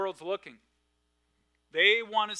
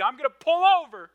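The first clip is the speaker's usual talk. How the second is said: exasperated parent threat; 9 semitones higher, 11 dB louder than usual. Retold or imitated. Parent warning kids in back seat.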